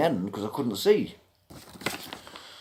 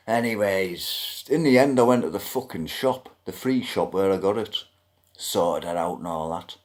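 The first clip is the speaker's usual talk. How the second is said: ordinary talk, nothing marked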